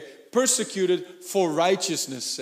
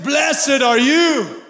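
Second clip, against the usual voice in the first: higher, loud, slow Blessed are you; proclaimed shout; 5 semitones higher, 4 dB louder, much slower than usual. Acted. Preacher to congregation, shouting the blessing slowly.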